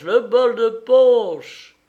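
high, loud chant with the end stretched out; rowdy shout. Acted. Revellers calling for the punch bowl, rowdy shout. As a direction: holler slowly for the drink.

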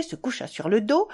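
usual speaking voice

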